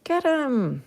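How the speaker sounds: high mock yell from the stands; wrestling crowd heckler. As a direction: egg on the wrestler like a fan. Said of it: "they're like, they're like".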